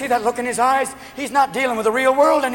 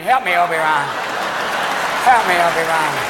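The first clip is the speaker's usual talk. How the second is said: Princess Leia plea; 4 semitones lower than usual. Acted. Nancy as space princess begs Ron, mock plea. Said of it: "Nancy has two Danishes strapped to her head going".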